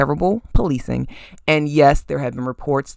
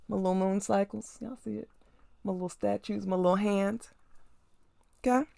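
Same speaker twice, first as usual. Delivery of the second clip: hushed, quieter show-and-tell; soft aside; 5 semitones higher, 12 dB quieter than usual. Real, not acted. Showing viewers her shelf, softly.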